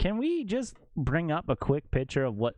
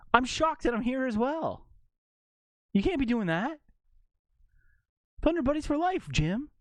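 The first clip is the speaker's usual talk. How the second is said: mock Wahlberg; 11 semitones higher than usual. Continuing the Wahlberg impression.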